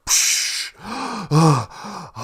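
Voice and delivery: big gasp on surfacing; loon gasping for air. Acted. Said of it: "It's really deep down there".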